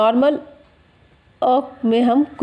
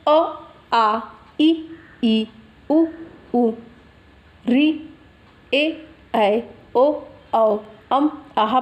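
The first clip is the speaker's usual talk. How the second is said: vowel recitation; much slower than usual. Shown as a demonstration. Teacher recalls how the vowels are read, measured recitation. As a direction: separate vowels with even pauses. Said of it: "पढ़ते हैं".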